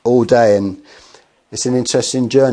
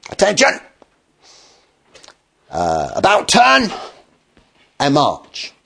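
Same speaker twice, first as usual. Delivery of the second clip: barked army orders; 5 semitones higher than usual. Retold or imitated. Alexander ordering his soldiers toward the cliff, barking drill calls. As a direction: shout it across the parade ground.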